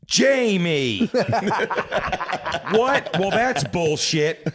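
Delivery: high, drawn-out screech of an appalled mother; hammed-up scandalized mom; outraged old mother. Mother scolding her son as his messages are read out, hammed-up outrage. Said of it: "a weird old twat".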